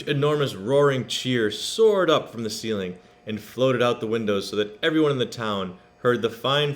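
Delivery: usual speaking voice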